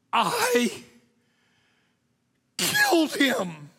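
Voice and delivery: high, loud, slow confession; anguished cry. Acted. Preacher confessing dramatically.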